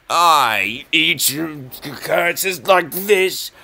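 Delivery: slow, rising to high loud boast, then a villain laugh; mock bravado. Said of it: "trying to sound confident".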